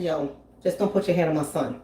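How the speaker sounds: low, soft and deadly calm; firm quiet warning. Retold or imitated. Comedian to stranger, quietly warning her off. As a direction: calmly warn her off your son.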